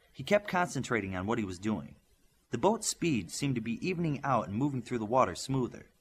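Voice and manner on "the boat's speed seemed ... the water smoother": ordinary delivery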